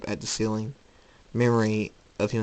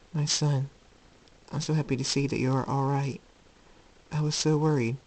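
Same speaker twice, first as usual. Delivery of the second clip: higher, gentle motherly voice; loving mother, relieved; 4 semitones higher than usual. Acted. Luna waking to see her son, gently relieved. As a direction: greet your child with tender relief.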